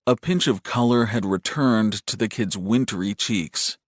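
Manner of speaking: ordinary delivery, nothing marked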